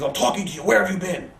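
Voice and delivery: loud, high imitation of an angry boss; retold angry yelling. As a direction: yell it like a boss tearing into someone. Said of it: "Vince starts yelling".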